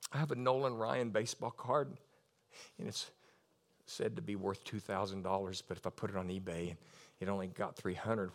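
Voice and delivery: low, hushed, intimate reassurance; quiet counsel, close to tears; tender personal ministry. Real, not acted. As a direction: speak softly and gently, with long pauses. Ministers softly to one woman.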